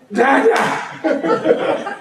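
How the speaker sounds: put-on high breathy baby cry of Dada; baby godlets. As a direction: call out like toddlers seeing their father. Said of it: "they saw me and they went".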